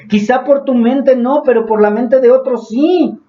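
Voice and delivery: ordinary delivery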